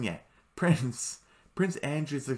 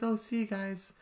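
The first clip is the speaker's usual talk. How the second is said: high farewell; 10 semitones higher than usual. Retold or imitated. Vlogger voicing the prince parting from friends, raised pitch. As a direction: lift it up gently. Recapping prince saying goodbye.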